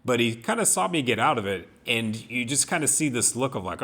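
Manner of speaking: ordinary talk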